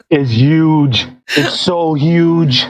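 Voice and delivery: slow, drawn-out tough-guy impression; Arnold Schwarzenegger. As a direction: stretch it out like a hammed-up action star. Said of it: "You sounded like Arnold Schwarzenegger".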